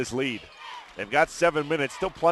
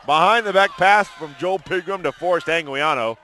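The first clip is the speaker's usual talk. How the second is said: high, loud recap of the play; excited play-by-play; 6 semitones higher, 7 dB louder than usual. Real, not acted. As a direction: relive the big pass at full energy. Recapping the big play live.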